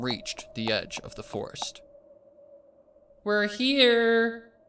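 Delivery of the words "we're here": much higher, louder joyful call; happy call. A narrator's reading. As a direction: call out to someone in the forest. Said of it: "Pinkamena called out happily".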